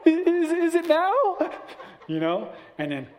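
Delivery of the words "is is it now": put-on very high, loud, slow laughing kid voice; nervous child. Child on the bed asking dad, high squeaky plea. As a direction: stretch it out like a scared kid, giggling.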